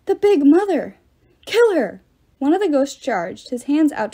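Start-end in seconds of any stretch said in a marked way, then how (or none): 0.0-2.0 panicked shout. A narrator's reading. Ghost panics and attacks.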